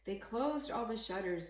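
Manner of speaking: ordinary delivery, nothing marked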